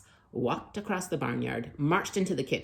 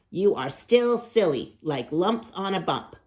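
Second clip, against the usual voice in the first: scolding; 4 semitones higher, 7 dB louder than usual. Acted. Megan scolds the pigs.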